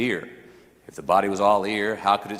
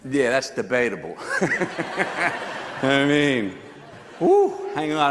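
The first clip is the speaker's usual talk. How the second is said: chuckling through the words at his own joke; laughing; 5 semitones higher than usual. Real, not acted. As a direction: laugh it off, amused. Jokes about losing his hair.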